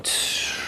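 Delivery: unvoiced tsh of a popping balloon; a soft hiss like air escaping; deflating hiss. Shown as a demonstration. Showing the balloon going flat, a hiss. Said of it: "it's going".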